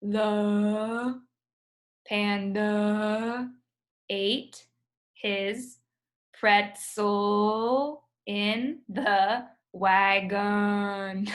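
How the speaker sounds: very slow, drawn-out "The panda ate his pretzel in the wagon"; slow schwa reading. Shown as a demonstration. Teacher demonstrates the sentence for the class, very slow. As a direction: stretch every word lazily. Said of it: "you should try reading it like that".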